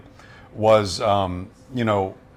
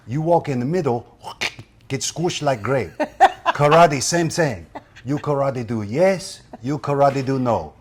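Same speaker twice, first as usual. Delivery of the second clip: higher, calm Miyagi voice in broken English, slipping into laughs; Mister Miyagi impression; 3 semitones higher than usual. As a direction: teach Daniel gently as Miyagi. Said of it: "Pat Morita as Mister Miyagi".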